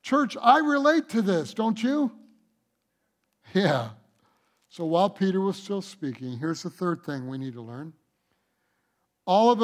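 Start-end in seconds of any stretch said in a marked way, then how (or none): none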